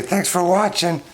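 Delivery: very high squeaky monkey voice; high cartoon pet sign-off. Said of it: "Somebody say".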